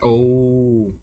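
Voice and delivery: ordinary delivery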